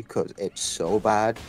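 ordinary delivery

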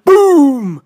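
loud, high drawn-out boom; explosive storybook sound effect; booming bang. A narrator's reading. Story's spell goes bang.

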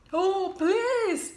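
high, loud sniffly begging; sniffled plea. Acted. Wolf begging the two pigs. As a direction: beg through a runny nose. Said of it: "sniffled Big Bad".

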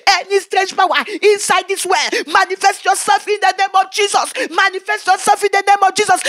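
loud, high deliverance prayer; retold shout of prayer; forceful prayer. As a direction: command the spirit at full strength. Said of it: "I was praying with all my strength".

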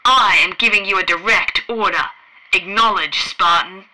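loud, furious dressing-down; angry admiral. A narrator's reading. The Admiral berates Fred and demands he obey, loud and angry. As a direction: hammer the order home.

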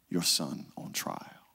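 slow whisper; hushed. Real, not acted. Preacher to mothers in congregation, slow grieving whisper. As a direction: breathe it out, grieved. Sermon imagines mother's grief.

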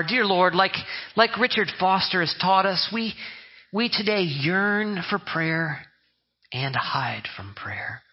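slow, hushed, ending in a whisper; well-read prayer. Retold or imitated. Pastor mocking a bookish pray-er, slow and breathy. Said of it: "show God and the people around you how well read you are".

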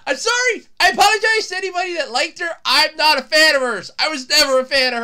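high, loud, insistent apology; emphatic apology. Real, not acted. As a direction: call out an unrepentant apology. Loud unrepentant apology to fans.

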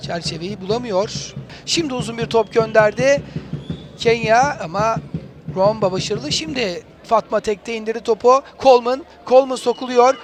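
normal talking voice

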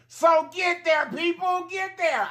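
high-pitched rallying cry; high exhorting shout. Real, not acted. Exhorting viewers.

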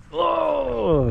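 a high, thrilled oh as the swing starts; excited oh. Real, not acted. Swinging at the beach park, high thrilled oh. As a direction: let the delight jump up high.

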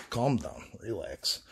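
ordinary talk

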